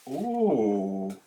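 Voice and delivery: ordinary talk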